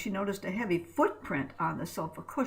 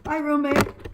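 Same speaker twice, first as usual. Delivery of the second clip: bright, much higher and louder hello; cheery greeting; 7 semitones higher, 7 dB louder than usual. A narrator's reading. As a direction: call out hi with big cheer. Ray greets his roommate Kurt.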